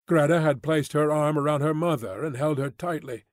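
ordinary delivery, nothing marked

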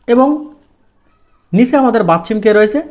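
usual speaking voice

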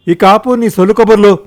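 wife yelling at her husband; loud yell. Acted. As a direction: shout her down to stop his chatter. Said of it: "గట్టిగా అరిచింది నాగాంబ".

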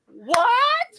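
normal talking voice

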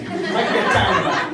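loud, eager approval; really into the pizza, loud; excited. Retold or imitated. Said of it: "And I am like".

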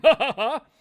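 loud, high scornful ha ha ha; mocking laugh. First man mocking the pie maker, loud scornful laughter. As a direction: laugh right in his face.

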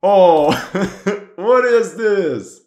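high, loud oh with a laugh, then a slow what is this; amused disbelief at the outdated kit; delighted surprise. Real, not acted. Historian spotting the wrong uniform, laughing surprise. Spots an old uniform in the game.

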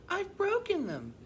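very high, acted wail of dismay; hammed-up tearful dismay; distressed cry. A narrator's reading. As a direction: wail it like an upset mother hen. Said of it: "cried Dora".

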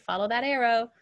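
ordinary talk, nothing marked

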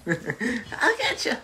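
mock-menacing, high and drawn out; slow, creepy sing-out with a laugh behind it; Mister X voice. Told to make him menacing, slow high mock menace. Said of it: "I want him to be menacing".

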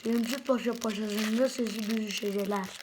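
tongue twister with a mouth full of gum. Real, not acted. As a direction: say it around a huge wad of gum. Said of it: "ağzımın şurası doldu".

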